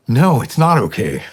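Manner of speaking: insistent correction, acted out; emphatic protest. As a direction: push back firmly on the child's forgiveness. Retold protest to forgiving son.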